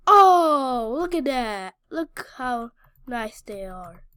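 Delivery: ordinary talk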